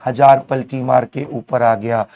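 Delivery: ordinary delivery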